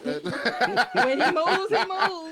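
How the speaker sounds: drawn-out, laughing preacher line; mock preacher. Joking with friend, laughing mock sermon. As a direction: preach it slow through laughter.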